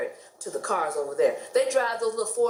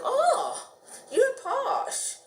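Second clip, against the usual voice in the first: impressed strangers; much slower than usual. Retold or imitated. Train strangers reacting to Lytham.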